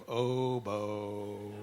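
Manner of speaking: long, sustained oboe note on the word; drawn-out droning oboe tone; oboe imitation. Shown as a demonstration. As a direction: intone "oboe" slowly, holding the last vowel like an instrument. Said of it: "into, um, the symphony, think of oboe".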